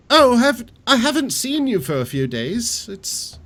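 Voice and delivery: high, surprised innkeeper voice. Acted.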